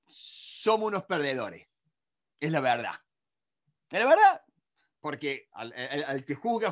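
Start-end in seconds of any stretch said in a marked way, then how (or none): 2.4-3.0 said low and flat; low flat statement. Shown as a demonstration. Repite la frase contrastando. 3.9-4.4 high, loud rising insistence; emphatic high exclamation. Shown as a demonstration. Repite la frase contrastando.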